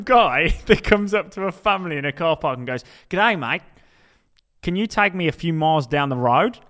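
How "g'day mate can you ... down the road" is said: hammed-up Australian accent, louder; mock Australian accent. Stranger asking a family for a lift, mock Australian accent. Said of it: "continue with the accent".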